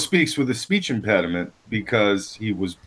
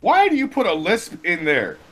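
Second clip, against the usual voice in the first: high laughing tease; 11 semitones higher than usual. Real, not acted. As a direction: crack up while mocking. Teasing, laughing, rising pitch.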